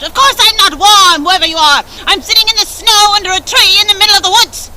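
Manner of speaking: high, loud and rude; sour stepsister snapping. A narrator's reading. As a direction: snap back rudely. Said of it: "sour-faced stepsister cried out".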